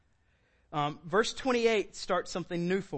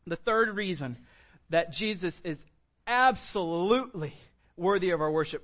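ordinary talk, nothing marked

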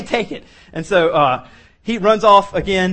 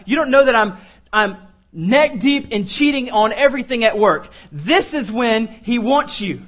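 preaching at full force; 3 semitones higher than usual. Acted. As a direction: preach it to the whole church. Altar call preaching.

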